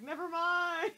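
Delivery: high, loud brush-off; mock dismissal. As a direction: sing out a comic give-up. Retelling giving up on explaining.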